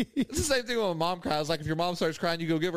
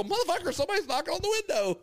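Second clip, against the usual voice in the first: high, fast mock alarm; retold panic; 11 semitones higher, faster than usual. Man telling his companion about the knocking, retold panic. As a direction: rush it out high-pitched, in comic panic. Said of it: "If this guy's panicking".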